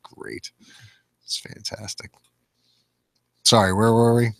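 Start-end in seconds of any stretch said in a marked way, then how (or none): 0.0-2.1 sarcastic whisper; a dry, voiceless aside. Pretend. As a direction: breathe the words with mock delight. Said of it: "whispers sarcastically".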